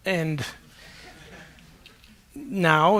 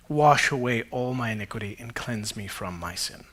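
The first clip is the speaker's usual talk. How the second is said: scripture reading; 8 semitones lower, 7 dB quieter than usual. Acted. Pastor reading David's psalm to the church, low and hushed. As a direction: read it as a hushed prayer.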